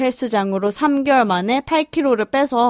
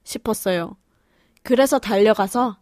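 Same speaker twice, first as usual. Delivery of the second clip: ordinary talk, nothing marked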